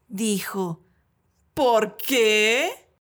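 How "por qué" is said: disdainful, challenging question; scornful. A narrator's reading. Mayor questioning why the piper should be paid, scornful. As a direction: ask it with haughty disdain. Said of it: "miró con desprecio al flautista".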